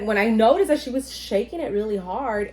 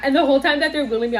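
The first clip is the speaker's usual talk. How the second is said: ordinary delivery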